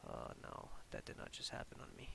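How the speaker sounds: real shocked whisper; whispered dismay. Real, not acted. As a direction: whisper it in disbelief. File may be corrupt.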